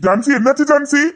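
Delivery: high, urgent cries for help; frantic shouts for help; panicked calling. Acted. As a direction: call her name in alarm. Calling out to fainted woman.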